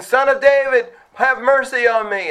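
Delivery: a blind man begging, high and pleading; crying out for mercy. Retold or imitated. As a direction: cry out to be heard from the roadside. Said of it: "he's crying out".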